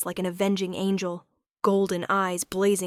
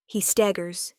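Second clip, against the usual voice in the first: plain reading voice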